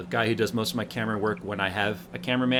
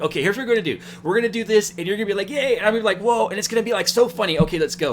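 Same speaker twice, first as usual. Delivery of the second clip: excited director; 7 semitones higher, 3 dB louder, faster than usual. Retold or imitated. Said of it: "I'm like".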